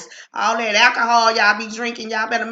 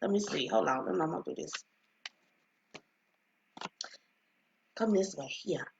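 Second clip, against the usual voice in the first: hushed aside; 9 semitones lower, 16 dB quieter, faster than usual. Real, not acted. Quietly handling grandbaby off-mic.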